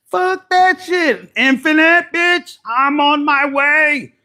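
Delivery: high, loud, drawn-out holler; defiant shout. Acted. Querent setting off to infinites, defiant high shout. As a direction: belt it out with total defiance.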